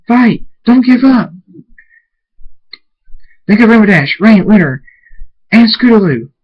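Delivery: loud, high, desperate rallying cry; screamed urging. A narrator's reading. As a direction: scream encouragement at a struggling companion. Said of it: "Draconis screams".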